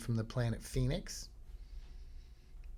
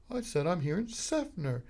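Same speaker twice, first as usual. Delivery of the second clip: higher, mannered outsider; refined visitor; 6 semitones higher than usual. Retold or imitated. Visitor answering the local, mannered and higher. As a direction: repeat it politely as the precious newcomer.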